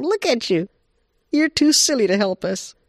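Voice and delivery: high-pitched mocking rabbit; teasing rabbit. Rabbit mocks Bobcat for wanting to help, high teasing. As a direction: poke fun in a high cartoon voice.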